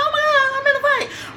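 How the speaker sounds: panicked squeak mid-fight; scared. Retold or imitated. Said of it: "Well, I'm scared".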